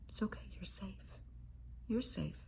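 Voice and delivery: tender near-whisper; soft reassurance. Acted. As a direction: gently comfort a frightened child, almost whispering. Reassuring a frightened child.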